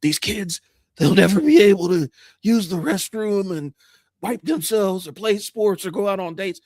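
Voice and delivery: lightly voiced mocking politician; mocking quote. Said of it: "where he's sitting up there talking about".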